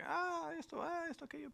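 high mock fussing; mock exasperation. Pastor imitating the family's grumbles, high. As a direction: toss off little complaints in a high voice. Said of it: "así como que".

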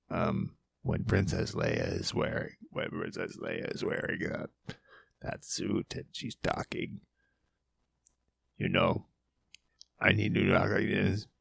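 strained voice on held breath; Star Wars suit voice. Shown as a demonstration. Host imitating Leia's suit voice, strained on held breath. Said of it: "had to hold my breath all weird".

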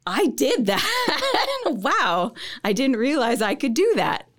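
gasp, then high, slow, laughing wonder; breathless and thrilled at what they made; amazed delight. Retold or imitated. Student proud of finished project, gasping in amazed delight.

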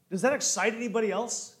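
ordinary talk, nothing marked

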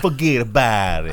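hammed-up Italian papa ranting; Robert De Niro. Host parodying the trailer's Italian father, mock Italian accent. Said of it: "Robert De Niro's running around going".

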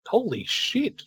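high, sharp holy shit; stunned exclamation. Real, not acted. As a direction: blurt it out in shock. Reacting to a big strike.

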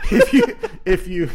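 high laugh into the words. Real, not acted. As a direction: talk through a burst of laughter. Laughing at the idea of disguising voices.

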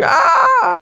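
high mock scream of pain; imitated cry of pain from the needle; pained yelp. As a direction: yelp like a needle just hit the roof of your mouth. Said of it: "he's like".

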